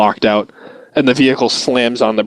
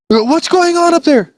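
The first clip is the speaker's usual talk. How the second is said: shouting driver. Retold or imitated. Driver shouting up to the gunner, alarmed. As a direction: yell up to the turret. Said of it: "And you".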